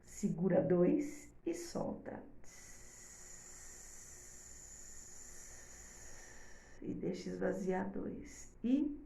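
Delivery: soft, slow breathing instructions; calm breathing guide. Shown as a demonstration. Teacher leading mothers through calming breath, soft and slow. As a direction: guide the breath gently, slowly. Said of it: "Aí você faz assim".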